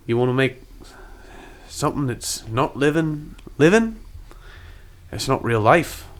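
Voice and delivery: koala man in mock-Aussie; measured advice in a put-on Australian accent; attempted Australian accent. Koala explains limits of magic to Elijah, attempted Australian accent. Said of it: "How do we do Australian?".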